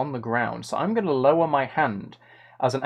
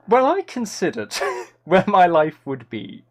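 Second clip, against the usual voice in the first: ordinary talk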